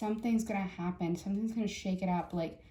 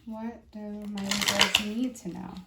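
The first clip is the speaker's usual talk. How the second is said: slow, louder, drawn-out question to the cards; slow invocation; 4 dB louder, slower than usual. Real, not acted. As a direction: call on the deck slowly and deliberately. Invoking the cards slowly.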